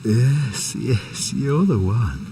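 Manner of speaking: ordinary delivery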